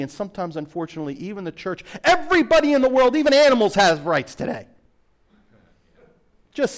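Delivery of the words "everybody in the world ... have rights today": louder and higher, building emphasis; a rising pulpit outburst; preaching peak. Real, not acted. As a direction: hammer this line home from the pulpit.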